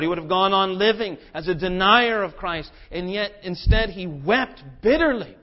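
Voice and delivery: loud, driving preacher's voice; fervent preaching. Real, not acted. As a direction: press the point hard to the congregation. Preaching at full force.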